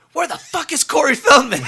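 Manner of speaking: high, loud yell breaking into laughter; urgent yell. Retold or imitated. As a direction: shout it like a frantic messenger. He repeats [name]'s line to photographer.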